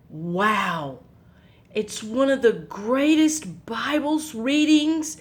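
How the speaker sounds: plain reading voice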